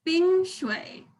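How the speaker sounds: "Bing shui" with level high first syllable; first tone, third tone. Shown as a demonstration. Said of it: "this is first tone".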